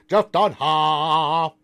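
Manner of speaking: loud, slow puppet voice leaping very high at the end; Peanut. Retold or imitated. Streamer performs a puppet's catchphrase for friends, drawn-out puppet voice. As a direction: stretch it out like a squawking dummy. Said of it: "Peanut voice".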